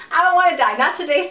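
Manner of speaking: loud, high, hammed-up wail; mock panicked cry. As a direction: cry out in exaggerated dread. Retelling terror while held over balcony.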